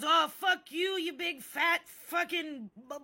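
high, loud mock tirade; hammed-up angry shouting; big and bad. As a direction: imitate a blustering bully yelling insults. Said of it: "all big and bad".

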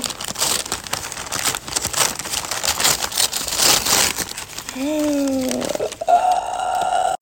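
long excited gasp; delighted gasp. Real, not acted. Opening bag of treats.

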